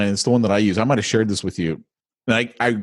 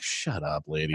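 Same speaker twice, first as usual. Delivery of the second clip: much softer, muttered dismissal under the breath; a low, muttered "shut up, lady" as if to himself; retold brush-off; 8 semitones lower, 12 dB quieter than usual. Shopper brushes off the cashier, muttered quietly. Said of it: "and I'm like".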